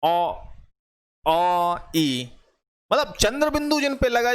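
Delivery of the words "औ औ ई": औ and ई sounded through the nose; nasal vowel demo. Shown as a demonstration. Teacher showing nasal vowels to class, nasalised model sounds. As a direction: let औ ring nasally. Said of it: "जैसे".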